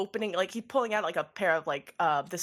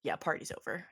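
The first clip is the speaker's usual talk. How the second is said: deadpan cop line; 4 semitones lower, 8 dB quieter than usual. Acted. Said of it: "Party Frog says".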